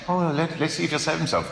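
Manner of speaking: high, sing-song sneering voice; mock him like a jeering ruler; high taunting sneer at the dying man. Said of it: "They're mocking him".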